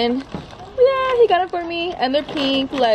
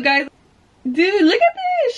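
high, gushing over a gift; excited squeal; 8 semitones higher, slower than usual. Real, not acted. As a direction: squeal with delight at a present. Opening mom's gift.

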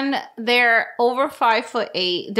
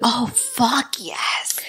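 breathy whispered excitement; whispering; much slower than usual. Real, not acted. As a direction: hush it like a secret. Reacting to night witches.

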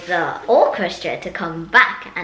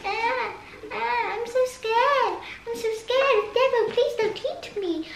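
put-on very high, trembling frightened baby; scared baby voice. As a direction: squeak it like a terrified baby. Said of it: "'cause it's like".

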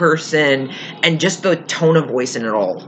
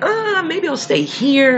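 much higher, slow, hesitant mumble; a timid, unsure take; hesitant reluctant voice; 12 semitones higher, slower than usual. Retold or imitated.

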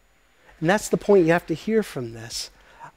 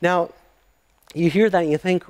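ordinary talk, nothing marked

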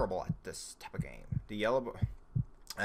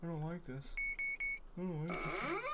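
small, soft, higher voice; uneasy murmur; 7 semitones higher, 10 dB quieter than usual. Real, not acted. Player creeped out by the game, quiet unease. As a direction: say it softly and nervously.

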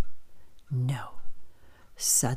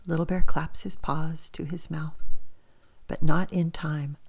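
plain reading voice

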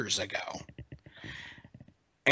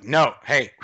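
retold cop cutting in, louder; firm interruption; 6 dB louder than usual. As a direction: imitate an officer calling a halt. Deputy breaking up a quarrel.

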